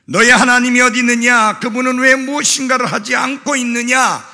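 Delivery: rapid, high shouted taunt; imitated jeering, loud and fast; mocking shout. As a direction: fire off the scoffers' question in one loud rush. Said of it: "라고 비웃고 조롱할 때에".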